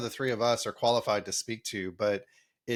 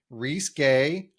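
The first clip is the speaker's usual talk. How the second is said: said slowly; 2 semitones higher, 3 dB louder than usual. Real, not acted. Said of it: "I said it slowly".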